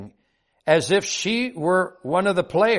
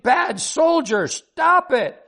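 high, drawn-out mock scream, rising higher; high-pitched indignant cry; child's scolding scream; 10 semitones higher, slower than usual. A narrator's reading. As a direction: act out a little girl scolding in outrage. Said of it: "She screamed".

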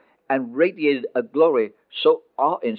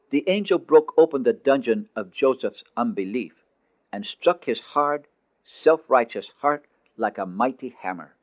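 usual speaking voice

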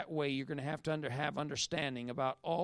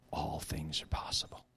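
hushed, much lower, breathed out; whisper; 11 semitones lower than usual. Real, not acted. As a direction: lean in and breathe the promise. Hushed whisper after shouting.

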